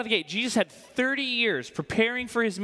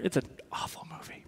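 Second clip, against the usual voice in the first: soft, conspiratorial whisper of a confession; a whispered jab at the movie; whispered aside; 2 semitones lower, 9 dB quieter than usual. Real, not acted. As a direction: tell it under your breath like a secret. Whispered aside about the film.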